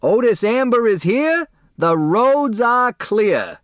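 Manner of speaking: loud high announcing yell, the second line slow; a big slow holler. Acted. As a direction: bellow the news to everyone. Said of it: "and yelled".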